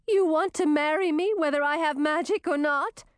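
high, tearful princess voice; through tears. A narrator's reading. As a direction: ask in hopeful disbelief, mildly tearful. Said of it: "asked through her tears".

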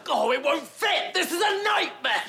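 high, desperate yelling; panicked shout. Acted. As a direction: cry out in frustrated panic. Sculpture stuck in doorway.

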